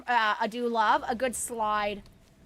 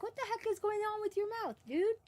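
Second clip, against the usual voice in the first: lightly voiced, higher dog talk; quick, pitched-up imagined dog voice; voicing the dog; 7 semitones higher, 3 dB quieter than usual. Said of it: "being like".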